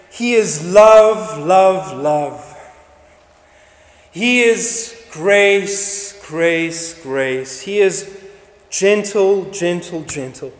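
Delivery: very slow, each repeat fading away; drawn-out mock praise. Preacher mimicking what the angels might have said, slow and fading. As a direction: stretch each word, letting the echoes die off. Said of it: "Would you expect them to say".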